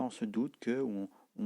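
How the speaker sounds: normal talking voice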